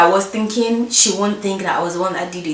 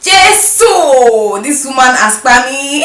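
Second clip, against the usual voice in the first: exasperated; 5 semitones higher, 7 dB louder, much slower than usual. Retold or imitated. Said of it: "I was like".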